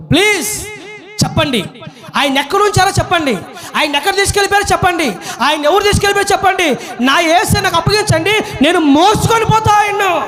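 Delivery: high, urgent, insistent begging; pleading Mary. Acted. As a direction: plead in rising desperation, phrase after phrase. Acts Mary desperately begging. (ఆవేదనతో ఎలుగెత్తి వేడుకుంటూ)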